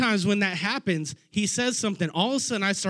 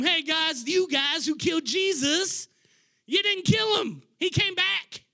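Peter's voice, much higher and shouted; impassioned preaching; 9 semitones higher than usual. Retold or imitated. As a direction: preach it hard at the crowd.

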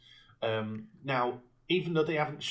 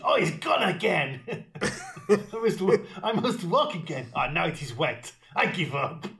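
put-on French accent; 3 semitones higher, 4 dB louder than usual. Henchman exclaiming.

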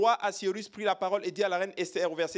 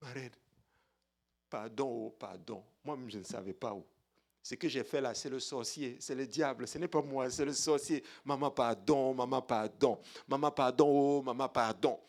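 Haman pleading softer and lower; begging Haman; 6 semitones lower, 5 dB quieter than usual. Acted. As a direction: grovel for mercy, quietly pleading. Haman begs the queen for mercy.

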